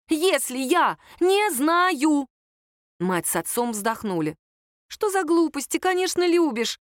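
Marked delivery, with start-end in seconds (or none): 0.1-2.3 slow, high, emphatic angry outburst; exasperated, drawn-out angry cry; growled outburst. A narrator's reading. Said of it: "прорычала Лера". 4.9-6.8 high-pitched, bewildered protest; small, high, flustered voice; flustered squeak. A narrator's reading. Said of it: "растерянно пискнула Надя".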